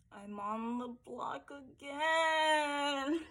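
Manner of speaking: soft, very slow and rising; drawn-out lament. Acted. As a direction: stretch every word out, climbing to the end. Contestant's mock confessional about nomination.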